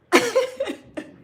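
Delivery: high, loud burst of laughter; helpless high laughter. Real, not acted. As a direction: crack up in a high-pitched laugh. Laughs at what they took.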